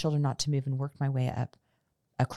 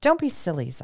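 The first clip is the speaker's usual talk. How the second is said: louder, sharp rebuke; sharply; 4 semitones higher, 6 dB louder than usual. A narrator's reading. Mother sharply scolds her frightened children, louder. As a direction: cut the children's panic short. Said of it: "I said sharply".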